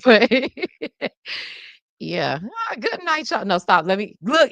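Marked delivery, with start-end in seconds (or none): 2.4-3.4 high put-on old lady sign-off; impression creeping back. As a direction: slip into a high granny voice. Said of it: "it keep trying to come in me".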